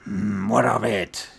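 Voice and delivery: loud, gruffly dismissive orc; orc child, defiant. Acted. GM voices orc child challenging Rokan.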